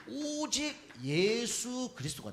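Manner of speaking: "오직 예수" drawn out long and high; high, solemnly lengthened "오직 예수"; emphatic. Real, not acted. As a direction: stretch the vowels of "오직" and "예수". Preacher stresses the key point.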